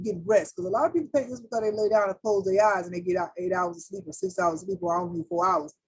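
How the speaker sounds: ordinary talk, nothing marked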